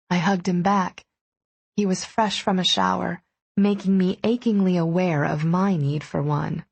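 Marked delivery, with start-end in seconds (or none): none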